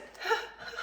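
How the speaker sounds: high-pitched laugh. Real, not acted. Amused at her own explanation, high laugh. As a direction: burst into a high giggle.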